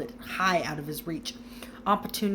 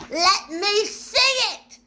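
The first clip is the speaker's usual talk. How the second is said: much higher, loud and slow; shouting; 14 semitones higher, 8 dB louder, slower than usual. A narrator's reading. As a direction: demand it like a spoilt child. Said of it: "shouted Dudley".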